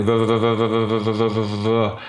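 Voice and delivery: imitating a client's teeth chattering uncontrollably; rapid stuttering vuh sounds, jaw clattering. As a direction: mimic a jaw rattling from cold and shock. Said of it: "can't stop his jaw from clattering".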